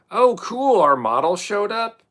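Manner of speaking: high, loud fake delight; sarcastic excitement. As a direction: announce it in mock cheer. Sarcastic announcement.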